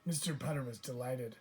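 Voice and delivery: plain reading voice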